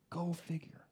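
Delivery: dry unvoiced whisper; whispered aside. Real, not acted. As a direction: whisper it knowingly. Wry aside after advice.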